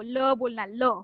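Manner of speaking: "ल" short, schwa vowel; clipped "ल", not "ले". Shown as a demonstration. Teacher modelling French "ल" for students. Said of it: "ले नहीं बोलना उसको".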